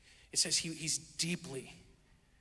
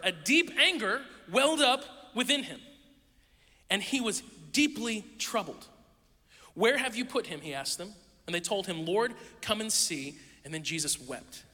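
measured reading of the passage, a little higher; solemn Bible reading to the congregation; scripture reading; 4 semitones higher than usual. Acted. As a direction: read the verses with weight. Reads John eleven aloud.

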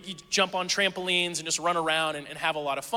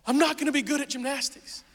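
higher, mock panicky little kid; upset child; 7 semitones higher than usual. Five-year-old son in car panicking about gymnastics. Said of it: "He's freaking out".

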